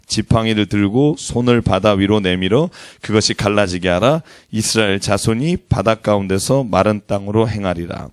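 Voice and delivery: steady, low reading of the verse; scripture reading. Acted. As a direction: read it aloud with the church. Congregational Bible reading. (성경 봉독하듯 차분하게)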